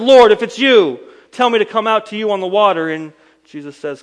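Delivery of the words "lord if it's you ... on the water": much higher and louder, bursting with eagerness; eager call. Acted. Impetuous Peter calling out to Jesus across the water, eager. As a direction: call out without thinking, all enthusiasm.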